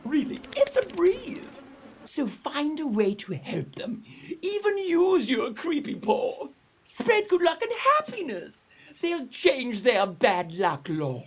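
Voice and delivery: lightly voiced wise flying fox. Flying fox explains her prank to the Ai Ai, kindly. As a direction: teach him a gentle lesson.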